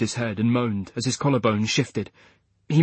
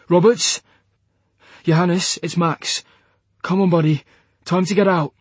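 loud, urgent call to a friend; 7 semitones higher, 5 dB louder than usual. Acted. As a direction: rouse him with a raised voice. Calling to unconscious pilot.

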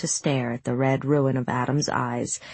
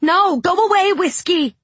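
high, loud panicked shout; 6 dB louder than usual. A narrator's reading. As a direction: yell to drive the dog off. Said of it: "Hazel shouted".

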